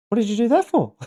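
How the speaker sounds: high-pitched mock disbelief; incredulous. Trapped man to the friend who jumped in, incredulous.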